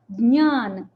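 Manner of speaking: ज्ञान as "dnyaan", nasal ny; j attempted, not spoken. Shown as a demonstration. Teacher models it for students, ज्ञान as "dnyaan". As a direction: aim for j but release "nyaan" in ज्ञान. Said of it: "ज की कोशिश होगी पर ज नहीं बोलेंगे".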